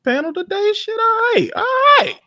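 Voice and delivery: rising, frantic all rights; building from mutter to yelling; excited panic. Retold or imitated. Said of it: "I was like".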